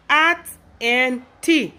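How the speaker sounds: "at and T" with AT as one word, slow and insistent; Liberian pronunciation. Retold or imitated. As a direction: say at and T stretched out, at read like the word. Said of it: "how you guys say things over there in Liberia".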